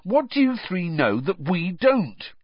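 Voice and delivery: put-on exasperated young wizard; irritable. A narrator's reading. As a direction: demand to be let in, irritably. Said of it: "said Ron irritably".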